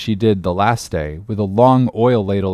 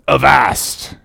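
sudden hail from a stranger; cried out; 4 semitones higher than usual. Acted. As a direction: call out "avast" in a character voice. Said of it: "cried a voice".

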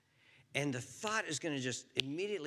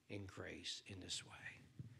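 whispered; 6 semitones lower, 14 dB quieter, slower than usual. Real, not acted. Closing prayer trails off.

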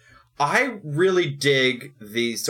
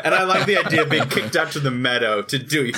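ordinary delivery

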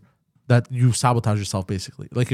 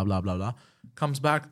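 ordinary delivery

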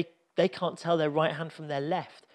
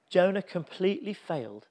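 usual speaking voice

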